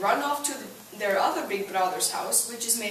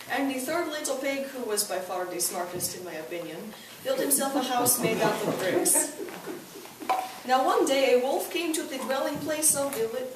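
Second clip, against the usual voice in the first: ordinary delivery, nothing marked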